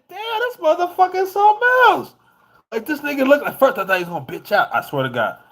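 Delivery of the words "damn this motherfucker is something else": high, loud astonished outburst; amazed and loud at the wild dog; amazed exclamation. Retold or imitated. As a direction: marvel out loud in shock.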